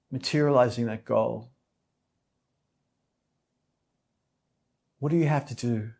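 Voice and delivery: calm meditation guide, unhurried with long pauses; soothing yoga teacher leading a meditation; guided meditation. Real, not acted. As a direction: settle them gently into stillness, phrase by phrase.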